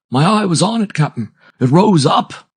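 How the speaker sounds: high, loud, excited helmsman; hoarse exclamation. A narrator's reading. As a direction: call it out to the captain, pitch soaring. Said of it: "exclaimed the hoarse voice".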